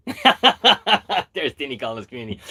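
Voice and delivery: amused at your own bluntness, laugh into the words; real laughing. Real, not acted. Host joking about calling his community idiots, laughing. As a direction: burst out laughing, then talk through it.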